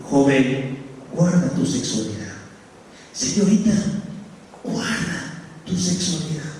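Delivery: low, grave divine warning; voice of God. Acted. God warning young men and women, grave and low. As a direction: speak as God, low and solemn. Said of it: "Dios te va a decir".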